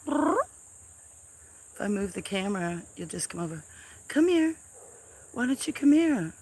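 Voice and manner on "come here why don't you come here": high sing-song call to a kitten; high coaxing call. Real, not acted. Owner coaxing the new kitten Bongo to come, high call. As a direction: coax the kitten over, high and bright.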